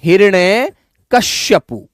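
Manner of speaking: हिरण्यकश्यपु said very slowly, final पु clear; slow dictation. Shown as a demonstration. Teacher dictating a tricky name, slow and careful. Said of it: "number बीसवाँ शब्द है".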